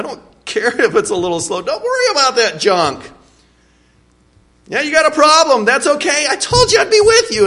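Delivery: high, laughing and fast reassurance; cheerful God, laughing. Acted. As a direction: shrug off the excuse with a laugh.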